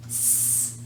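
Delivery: long voiceless "sss" for "S"; isolated S sound. Shown as a demonstration. Teacher pointing at the last letter, sustained "sss" for "S". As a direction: sustain "S" as pure air, not the letter name. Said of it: "Try to say the sound".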